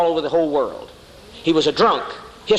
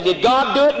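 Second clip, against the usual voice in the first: high questioner; 8 semitones higher than usual. Retold or imitated. Imagined questioner asks.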